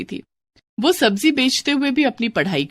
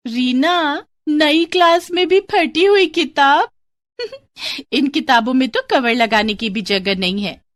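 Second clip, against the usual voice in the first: mocking classmate; 5 semitones higher than usual. Classmate mocks Reena for her torn old book, taunting.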